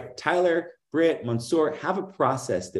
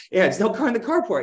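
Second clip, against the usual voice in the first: higher, incredulous report of the empty carport; mock dismay, pitched up; exasperated agent; 9 semitones higher, 3 dB louder than usual. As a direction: play a deflated rep telling the story.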